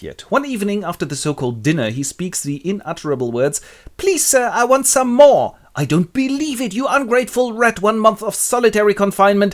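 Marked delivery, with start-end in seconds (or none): 4.0-5.6 high-pitched little beggar, ending slow and louder; pleading orphan boy. Acted. Hungry orphan asks for more, pleading high. 5.8-9.5 high-pitched outraged rebuke; indignant scolding. Acted. Workhouse master punishes the ungrateful orphan, high and indignant.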